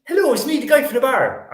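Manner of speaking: very high, loud comic caller; phone advert character. Retold or imitated. Quoting the Digiphone advert caller, high comic imitation. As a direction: squeal it like a silly ad impression. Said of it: "look up the esat Digiphone".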